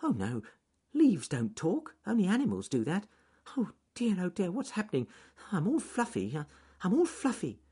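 high, flustered rabbit panic; frightened. A narrator's reading. Rabbit alarmed by a talking leaf, frightened and flustered. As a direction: stammer and fret in a high voice. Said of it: "he ran round and round in frightened circles".

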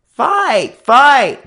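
very high, loud gang chant; shrieked mob howl. A narrator's reading. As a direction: egg on a fight. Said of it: "the gang began to howl again".

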